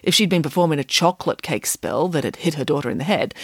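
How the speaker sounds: ordinary delivery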